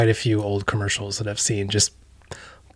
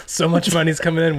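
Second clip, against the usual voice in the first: laughing; 6 semitones higher than usual. Real, not acted. Laughs over sponsor joke.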